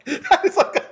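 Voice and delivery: ordinary delivery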